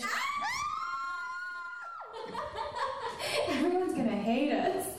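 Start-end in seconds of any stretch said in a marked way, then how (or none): 0.1-3.4 high shrieking burst of laughter. Real, not acted. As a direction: squeal with laughter. Big laugh at marriage topic.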